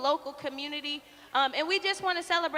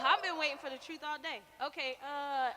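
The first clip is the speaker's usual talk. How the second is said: ordinary delivery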